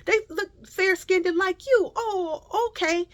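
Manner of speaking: high-pitched mock voice of a stammering lady; flustered store lady. Store lady fumbling over fair-skinned kids, mock flustered. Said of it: "And then the lady was like".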